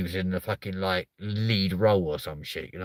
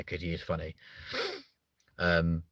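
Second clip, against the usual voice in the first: ordinary delivery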